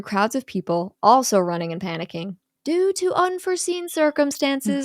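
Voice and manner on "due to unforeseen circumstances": higher, announcer-style PA voice; loudspeaker announcement. Retold or imitated. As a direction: read it like a park PA. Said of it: "a voice says over the loudspeaker".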